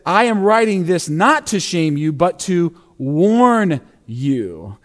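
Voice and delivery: "warn you" drawn out very slowly. Acted. Pastor quotes Paul again, slowing right down on "warn". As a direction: stretch "warn" out long and slow. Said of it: "that word warn".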